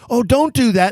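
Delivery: mock a sweet "oh no, don't cry"; light imitation of a cashier choking up herself; tender protest. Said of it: "she goes".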